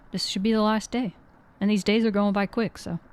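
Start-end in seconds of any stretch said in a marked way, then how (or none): none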